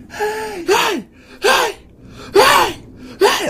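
high, loud panicked yelling; screaming hey. Retold or imitated. Man yelling at a figure by his bed, screaming. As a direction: scream it in terror at the dark figure. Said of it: "I'm just screaming it".

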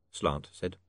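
plain reading voice

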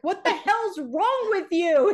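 high, loud, slow mock yell; the startled brother blowing up, hammed up; imitating angry outburst. Son scared mid-game, yelling at his sister in mock fury. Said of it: "and he's like".

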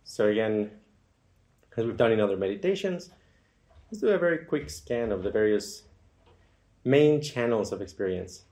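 unhurried meditation guide with long, settling pauses; guided meditation. Acted. Guide leads listeners into feeling comfortable and relaxing, guided meditation. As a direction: ease listeners gently into noticing the body.

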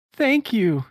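high cartoon thank-you; Sonic voice. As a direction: say it dreamily as Sonic. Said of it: "he said slowly".

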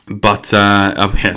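usual speaking voice

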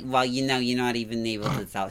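imitating her New York accent. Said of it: "You're like".

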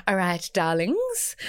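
a touch of posh English, slow and airy; lightly posh friend greeting everyone, slow; posh friend impression. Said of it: "This is what she sounds like".

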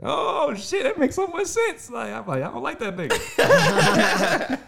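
high, slow, laughing realization; sudden realization. Retold or imitated. Boy learning his stepdad isn't his real dad, slow high laughing realization. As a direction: stretch it out high as it clicks. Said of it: "I said".

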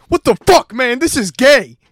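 loud, high-pitched yell of disgust; shocked outburst. Retold or imitated. Recoiling from a kiss behind the dumpster, shocked shouting. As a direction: burst out yelling in protest.

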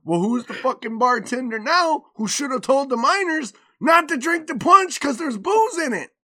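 high, loud mouthy backtalk; smart-ass kid. Retold or imitated. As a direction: imitate a cheeky child lecturing mom. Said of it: "my smart ass goes".

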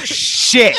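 high, exclaimed curse; acted "oh no" cursing; frustrated realisation. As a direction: blurt it as you realise you forgot something. Realising mask is forgotten at door.

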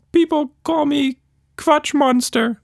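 very high squeaky creature voice; Quatch Monster voice. A narrator's reading. Creature telling Tilda its name, very high squeaky voice. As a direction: play a tiny chirpy monster.

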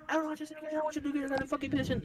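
high-pitched mock maternal rant; imitated scolding mom.

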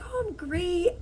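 imitating her own reply. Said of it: "I'm like".

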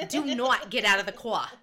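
loud, hammed-up stern mom with dropped R; serious mom impression. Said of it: "my mom looks at me and she's so ser".

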